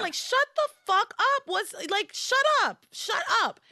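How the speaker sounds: high-pitched, exasperated outburst; fed-up Whitney. Acted. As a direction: act out someone who has totally had it. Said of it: "Whitney had had it".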